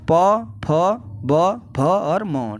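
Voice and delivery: प, फ, ब, भ, म said very slowly with the lips; reciting lip letters. Shown as a demonstration. Teacher demonstrating the lip letters slowly. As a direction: press the lips for each letter. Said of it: "ओष्ठ से".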